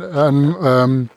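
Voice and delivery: ordinary talk, nothing marked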